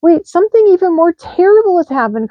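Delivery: high loud alarmed cry; urgent dramatic cry. A narrator's reading. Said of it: "cried Little Hedgehog".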